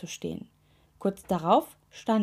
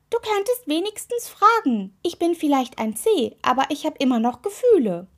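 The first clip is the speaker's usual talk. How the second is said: high, loud voice of an offended toe; offended complaint; 8 semitones higher, 5 dB louder than usual. A narrator's reading. Toe with hurt feelings telling the witch off, offended. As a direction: start high and indignant, then ease slightly.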